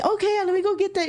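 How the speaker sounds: high, fast mimicry of the caller; exasperated rush. Mimicking the caller who keeps dropping the phone, high and fast. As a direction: rattle it off in a frantic high mimic. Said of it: "I'm like".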